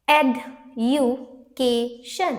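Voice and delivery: Education drawn out very slowly, e-du-ca-tion; slow syllable split. Shown as a demonstration. Teacher checking the syllable split aloud for students. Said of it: "it's right".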